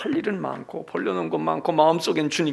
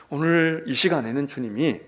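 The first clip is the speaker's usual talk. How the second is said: normal talking voice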